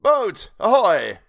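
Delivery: slow, high, very loud hail; long drawn shout across the sea. A narrator's reading. Will hailing the boat through his hands, hailing.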